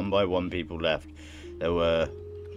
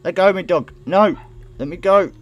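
high, very loud protest; shouted struggle against an animal; frantic shouting; 12 semitones higher, 14 dB louder than usual. Acted. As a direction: yell at the dog to release you. Shouting at the dog dragging them.

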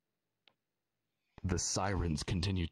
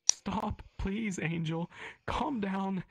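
pleading mother; 14 semitones higher, slower than usual. Acted. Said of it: "she pleads".

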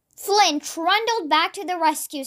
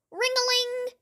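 high, held-out bell imitation; ringing bell; 8 semitones higher, 5 dB quieter than usual. A narrator's reading. The rescue bell rings at the center, high bell imitation. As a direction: sing out like an alarm bell, high and level. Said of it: "the rescue bell rang".

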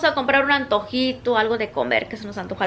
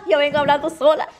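ordinary delivery

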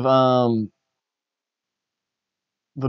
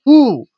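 much higher, louder cringe "ooh"; cringing ooh; 11 semitones higher, 9 dB louder than usual. Real, not acted. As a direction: wince out loud at the thought. Cringing at vice image.

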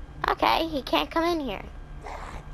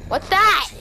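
ordinary delivery

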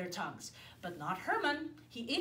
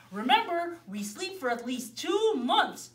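big bear; 5 semitones higher, 6 dB louder than usual. Acted. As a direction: remind everyone of the long sleep. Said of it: "said Big Brown Bear".

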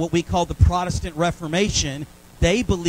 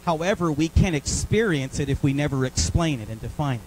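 usual speaking voice

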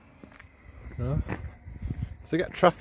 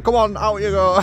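higher, louder shooing-out voice; mock staff voice; 10 semitones higher, 7 dB louder than usual. Restaurant staff telling a loiterer to leave, higher and louder. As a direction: play the waiter shooing him out, louder.

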